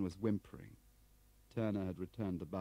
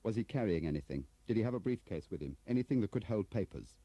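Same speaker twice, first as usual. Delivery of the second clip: louder, pressing questions; urgent questioning; 5 semitones higher, 6 dB louder than usual. Acted. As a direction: fire questions at the witness. Bradfield urgently questions Crabbe.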